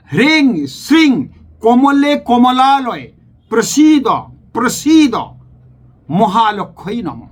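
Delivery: slow, careful chant of হ্রিং শ্রিং and কমলে কমলালয়ে, each syllable drawn out; clear mantra recitation. Shown as a demonstration. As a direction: chant প্রসীদ twice, slow and lifted. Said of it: "পরিষ্কার মন্ত্রটা উচ্চারণ করছি".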